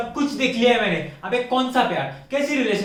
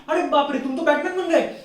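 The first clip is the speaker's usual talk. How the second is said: higher-pitched, gushing girlfriend imitation; mock gushing in a raised voice; mock awestruck girlfriend; 5 semitones higher than usual. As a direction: mimic her suddenly swooning over him. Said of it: "उस दिन उसको बोली".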